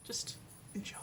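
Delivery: whispered, gentle; soft whisper. Real, not acted. Speaker whispers the advice to viewers, hushed. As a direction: breathe it out softly.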